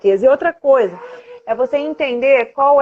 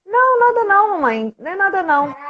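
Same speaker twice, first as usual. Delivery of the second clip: imitating the little girl, much higher on the first no; a quick, pitched-up child's denial; daughter's denial; 8 semitones higher than usual. Daughter voiced denying in story.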